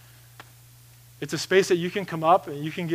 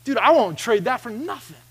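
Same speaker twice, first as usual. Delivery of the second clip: emphatic reply; 6 semitones higher, 6 dB louder than usual. Retold or imitated. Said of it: "And I say".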